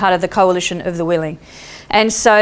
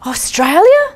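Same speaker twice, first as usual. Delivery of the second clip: high, louder questioning echo; surprised; 7 semitones higher, 4 dB louder, slower than usual. Retold or imitated. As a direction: repeat it astonished. Man repeats in surprise.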